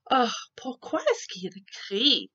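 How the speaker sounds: ordinary delivery, nothing marked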